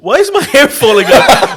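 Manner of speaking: high, loud mock German accent, laughing; hammed-up German poisoning victim. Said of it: "I made a German accent".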